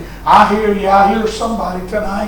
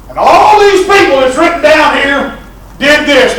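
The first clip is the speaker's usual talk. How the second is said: higher, louder mock reading of friend; hammed-up friend skipping the names; retold friend dodging names; 9 semitones higher, 9 dB louder than usual. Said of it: "he looked at that verse and he said".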